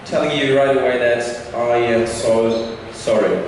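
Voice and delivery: normal talking voice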